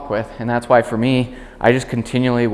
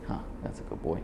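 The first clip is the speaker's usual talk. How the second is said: whispered, much softer good dog; soft praise; 5 semitones lower, 17 dB quieter, faster than usual. Real, not acted. Trainer softly praises the dog at her side. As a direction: murmur it just to the dog.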